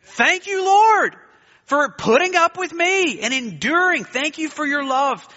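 a high, loud cry of praise. Shown as a demonstration. Preacher showing the congregation how to answer, crying praise. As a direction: call out thanks to the Lord.

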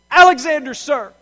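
loud, high shouted reply; terrified shout. A narrator's reading. Soldier yelling his name back at the emperor, frightened shout. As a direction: shout the answer back in fear.

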